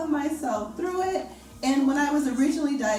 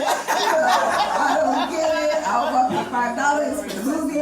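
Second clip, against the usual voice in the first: bemused disbelief; 3 semitones higher, 7 dB louder than usual. Real, not acted. Exasperated bemused marketer.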